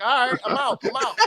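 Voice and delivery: mock huffy exit. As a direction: pretend to quit the talk in a huff. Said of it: "I was like".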